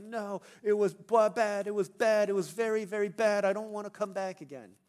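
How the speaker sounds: loud, high hammed-up child's whine; mock crying complaint. Child telling parents about first day, mock tearful protest. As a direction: protest like a sobbing preschooler, exaggerated. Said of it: "he was crying".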